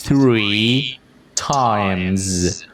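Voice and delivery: "Three times" slow and separated, each word stretched; careful model pronunciation. Shown as a demonstration. Teacher saying listen carefully, exaggerated slow "Three times". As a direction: draw out "Three", pause, then a long "times". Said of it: "Nghe kỹ nè".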